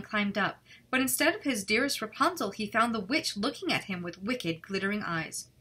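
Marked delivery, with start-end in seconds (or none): none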